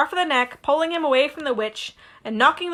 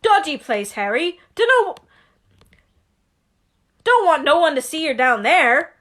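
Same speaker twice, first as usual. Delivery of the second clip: louder, lightly voiced giant warning; Hagrid voice; 4 semitones higher, 5 dB louder than usual. Hagrid warning Harry about the alley, lightly gruff. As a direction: warn him like a rough friendly giant.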